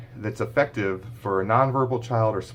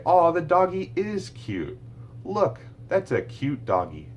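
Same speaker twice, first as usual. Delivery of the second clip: high, slow, sing-song modelling voice; slow parentese to a small child; warm recast; 10 semitones higher, slower than usual. Shown as a demonstration. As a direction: model it gently for a toddler. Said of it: "You could say".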